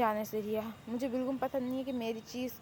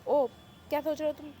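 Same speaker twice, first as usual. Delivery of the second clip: ordinary talk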